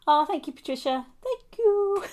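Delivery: high giggly thank you; giggling thanks. Real, not acted.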